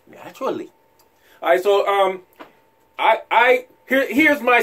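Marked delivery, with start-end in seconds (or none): 0.0-0.7 naturally through a chuckle, soft and falling; laughing. Shown as a demonstration.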